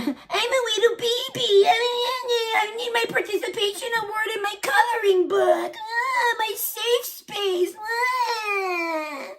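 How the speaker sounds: high whiny baby voice with fake sobbing; mock crybaby. Mocking millennials as whining babies wanting awards, fake crying. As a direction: whimper it like a spoiled toddler.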